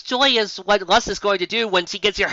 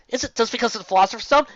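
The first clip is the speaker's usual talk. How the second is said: ordinary delivery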